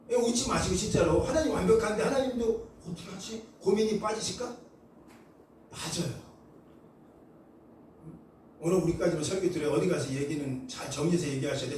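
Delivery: usual speaking voice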